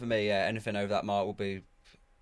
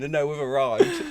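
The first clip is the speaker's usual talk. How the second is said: a touch of show-off star voice, a bit louder; mock celebrity; 4 semitones higher, 7 dB louder than usual. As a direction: announce your arrival like a celebrity. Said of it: "putting on the story saying".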